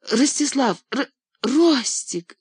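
high, loud squeal of his name; squeaky cry. A narrator's reading. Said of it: "неожиданно пискляво выкрикнула она".

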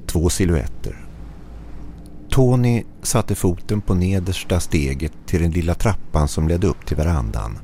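usual speaking voice

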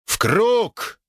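high barked order; rally the squad; shouted command. Acted. Knight commands formation. (командный выкрик)

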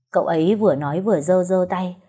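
plain reading voice

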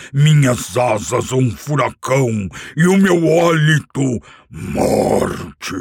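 loud, proud booming dragon brag; boastful dragon. Acted. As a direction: swell up like a bragging dragon. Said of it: "Vangloriou-se". (dragão se vangloriando em voz alta)

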